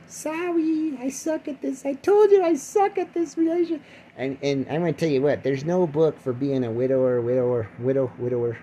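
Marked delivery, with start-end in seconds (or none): 0.1-3.8 very high sheepish voice; mock sheepish apology. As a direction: apologize to the ghost in a silly high voice. His reply to the ghost.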